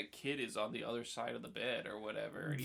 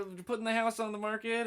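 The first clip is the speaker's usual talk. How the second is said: mocking the agent; 11 semitones higher, 7 dB louder than usual.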